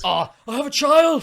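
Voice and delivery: high, loud film-quote shout; hammed-up plea at full volume; mock desperate cry. Acting out Cal's film line.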